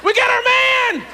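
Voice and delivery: high, slow triumphant shout; excited shout. Acted. Reporter shouting to his crew after finding the witness. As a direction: yell out the find with glee.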